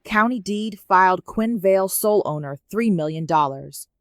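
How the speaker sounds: usual speaking voice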